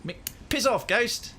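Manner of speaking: high, strong South African rock-monster voice; South African accent. Retold or imitated. Streamer imitating the rock monster from Thor in a South African accent. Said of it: "the strongest South African you can do".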